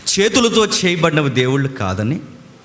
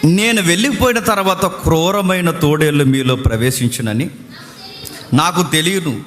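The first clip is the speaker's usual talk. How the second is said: raised, slow, solemn Bible reading; solemn raised scripture reading; 5 semitones higher than usual. Acted. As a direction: proclaim each verse slowly. Reads scripture aloud.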